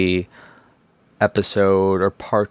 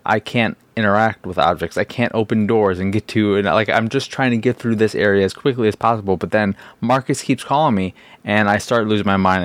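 normal talking voice

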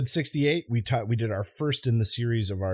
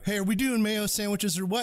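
cousin Bob; 10 semitones higher than usual. Retold or imitated. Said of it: "and said".

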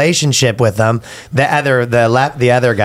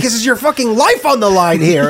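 laughing mock lawyer; 7 semitones higher than usual. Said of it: "He's like".

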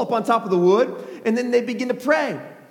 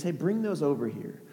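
quiet casual order; 4 semitones lower, 8 dB quieter than usual. Acted. Elijah casually directing the people.

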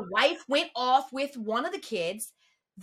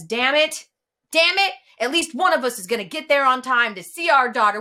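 exasperated outburst; 9 dB louder than usual. Retold or imitated. Husband's outburst at gate agent.

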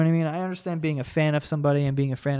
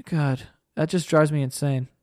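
normal talking voice

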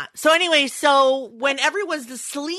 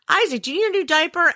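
put-on quick, higher call to a little child; माँ की पुकार; 7 semitones higher, much faster than usual. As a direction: ask fast and high, as a busy mom. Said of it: "Like, I'll go".

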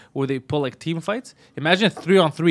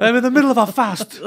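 high, loud comic protest; mock offence; 8 semitones higher, 6 dB louder than usual. Fighter refusing a lunch offer, hammed-up offence. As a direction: object as if deeply offended. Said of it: "he takes things personally".

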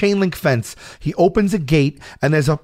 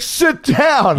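high heckler's yell; mock-yell it from rows behind; imitated yell; 9 semitones higher than usual. Guy behind him at concert, imitated yell. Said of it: "people start yelling at us".

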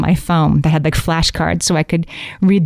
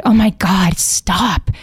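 eye roll; 2 semitones higher, much slower than usual. Pretend. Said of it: "push it away with an eye roll".

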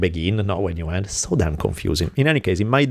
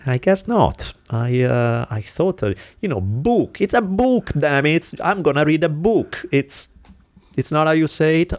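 put-on higher-pitched frustration with his own accent; dismayed self-correction; 7 semitones higher than usual. Speaker reacting to hearing himself mispronounce book, frustrated. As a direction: fret over how the word comes out. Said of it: "how they respond. And I'm like".

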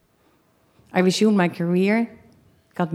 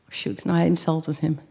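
normal talking voice

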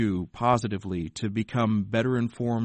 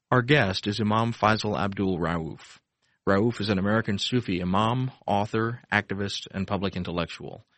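measured, polished announcer read; smooth public radio announcer voice; radio host intro read. Acted. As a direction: read the show opening like a broadcast intro. Host reads show intro again.

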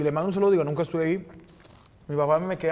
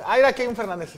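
mock surprise; 8 semitones higher, 8 dB louder, faster than usual. Finding a name on the list.

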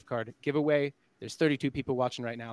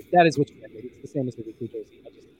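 underwater; 4 dB louder, faster than usual. Real, not acted. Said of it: "you sound a little bit underwater here".

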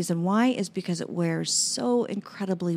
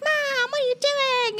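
put-on much higher whiny voice of a child doubting mom; mock whiny child. Mother imitating her child objecting to a treatment, high whine. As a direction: whine it like a kid to their mother. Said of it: "it's like".

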